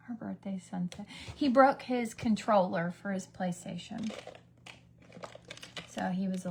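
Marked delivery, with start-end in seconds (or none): 0.1-1.1 soft unvoiced aside; whisper. Real, not acted. Telling viewers a secret aside, whispering. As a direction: breathe it out under the breath. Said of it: "aside whispered to camera".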